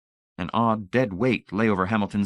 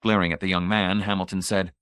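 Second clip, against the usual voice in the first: ordinary delivery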